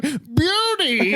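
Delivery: put-on happy old man calling his dog. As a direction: call out like a delighted old man.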